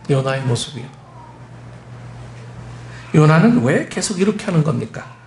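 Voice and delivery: ordinary delivery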